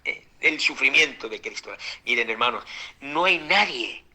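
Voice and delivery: ordinary talk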